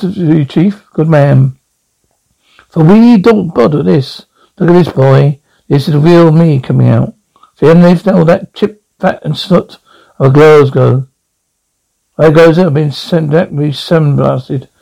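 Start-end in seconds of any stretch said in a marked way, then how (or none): none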